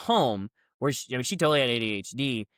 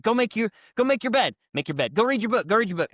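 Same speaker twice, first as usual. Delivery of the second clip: higher, louder barked commands; mock rapid drill orders; 8 semitones higher, 4 dB louder, faster than usual. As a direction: fire off orders like a drill sergeant. Instructors rapid-fire yelling commands.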